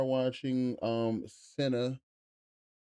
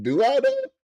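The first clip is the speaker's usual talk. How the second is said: teasing high question; 9 dB louder than usual. Real, not acted.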